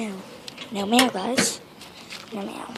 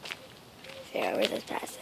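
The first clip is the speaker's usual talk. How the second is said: hoarse whisper from sore throat; breathy sick whisper; 9 dB quieter, slower than usual. Real, not acted. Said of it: "try talking with my regular voice".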